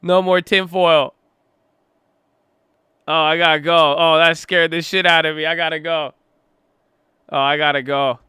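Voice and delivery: loud, high, shaken blurts after a fright, fading down; startled outburst. Real, not acted. Streamer jolted by a jump scare, loud and startled. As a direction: babble the same lines over and over, still rattled.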